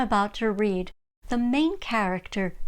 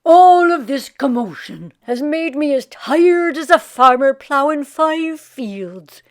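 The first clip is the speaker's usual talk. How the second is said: tired lazy Irishman; 3 semitones higher, 8 dB louder than usual. Acted. Jamie tired, to himself.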